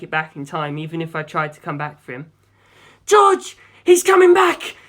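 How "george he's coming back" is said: loud, high roar of warning. A narrator's reading. Navigator roaring a warning to the pilot, loud. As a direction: bellow it over the engine noise. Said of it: "Jim roared behind me".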